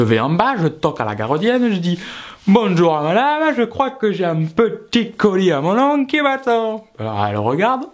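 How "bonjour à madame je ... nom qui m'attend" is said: slow, high singsong politeness; chirpy polite greeting. Retold or imitated. Customer asks caretaker for his parcel, chirpy singsong greeting. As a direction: stretch it out high and sugary.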